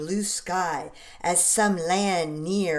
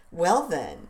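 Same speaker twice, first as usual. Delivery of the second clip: ordinary delivery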